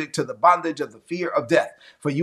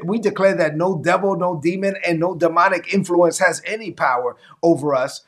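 usual speaking voice

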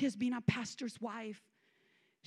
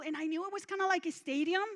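ordinary talk

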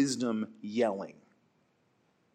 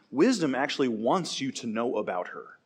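normal talking voice

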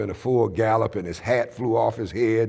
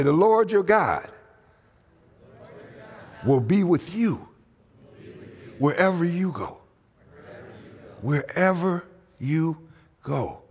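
call to repeat; 6 semitones higher than usual. Shown as a demonstration. Preacher leading the congregation in call and response. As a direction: lead the church in repeating it. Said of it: "look at somebody, say".

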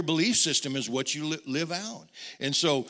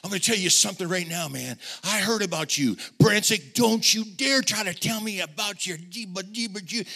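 loud, fast, higher coworker ranting; angry rant; 7 semitones higher, 7 dB louder than usual. Retold or imitated. Coworker Greg cursing at the new hire, loud angry rant. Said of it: "but he said".

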